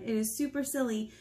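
plain reading voice

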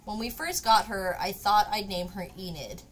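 usual speaking voice

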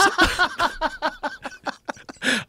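high laugh; real laugh. Real, not acted. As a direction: crack up remembering. Laughing at the memory.

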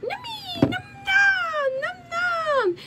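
very high squeaky critter voice; tiny creature squeak. Pretend. Popcorn toy creatures squeak.

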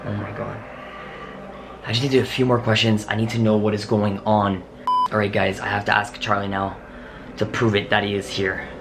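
ordinary delivery

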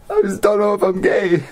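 usual speaking voice

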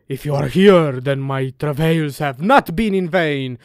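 loud, theatrical declamation; solemn proclamation, projected loudly; dramatic letter reading. Acted. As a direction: declaim the letter like a grand speech. Reads a letter from S aloud.

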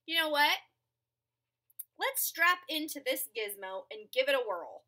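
plain reading voice

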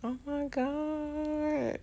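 slow, quiet oh my God on the edge of crying; real tears welling, voice slowed and softened; tearing up. Real, not acted. A vlogger overcome watching a tribute, near crying. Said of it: "I'm not ready".

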